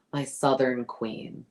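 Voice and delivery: light slow Southern drawl on "My Southern queen"; imitating Madison with a lightly drawled Southern accent. Quoting the castmate's line in a mild Southern accent. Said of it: "'Cause it's like the accent".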